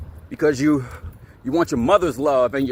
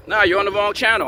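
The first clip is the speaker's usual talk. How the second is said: normal talking voice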